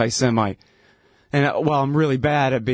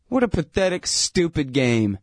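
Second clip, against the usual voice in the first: ordinary talk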